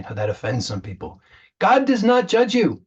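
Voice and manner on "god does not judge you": God does not judge you, said much higher and louder, GOD hit hard; emphatic repeat. Real, not acted. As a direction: proclaim it, lifting the voice high and loud on God. Repeats the line with more force.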